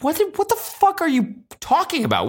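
as if you can't believe your ears; mock shocked inner voice; incredulous outburst. Baffled inner reaction.

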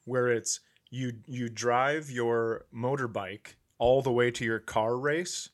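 ordinary talk

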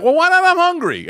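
high, loud whiny complaint. Retold or imitated. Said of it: "he's like".

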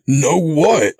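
loud, high, bewildered demand; crying out. A narrator's reading. Narrator demands answers from the captor, crying out. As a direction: burst out with the question. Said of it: "I cried".

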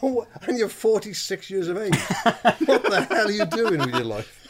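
slow, high mock lecture to a caller; lightly acted exasperated lecture; retold telling-off. Telling a prank caller off, slow and exasperated.

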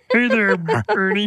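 high puppet voice; squeaky Muppet-style greeting; Ernie voice. Pretend.

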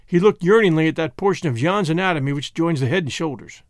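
usual speaking voice

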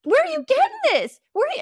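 high, loud incredulous question; shocked adult asking; disbelieving aunt; baffled. Retold or imitated. Adults baffled by child's words.

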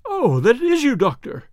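high exhaled cry, sinking at the end; relieved. A narrator's reading. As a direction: heave of relief from a nervous man. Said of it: "with a great heave of relief".

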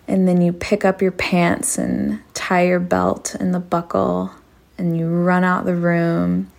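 ordinary delivery, nothing marked

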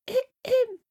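tiny high-pitched call; little voice. Acted. Heard by Jack wondering what to do, tiny high voice. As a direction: squeak it out small and high. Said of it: "when he heard little".

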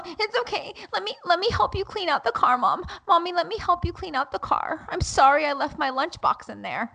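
put-on high, small child's voice, eager to make amends; pleading little son. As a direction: mimic a guilty little boy offering help. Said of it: "and then he'll go".

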